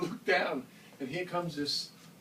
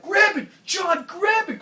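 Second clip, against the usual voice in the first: high, loud frantic order; urgent shout; 12 dB louder than usual. Retold or imitated. Jerry with a fish flopping, frantically yelling at John. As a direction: yell at him to grab the flopping fish. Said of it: "he's like".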